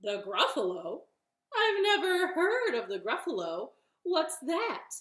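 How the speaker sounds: loud, doubting fox voice; puzzled fox. Acted. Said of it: "Fox said".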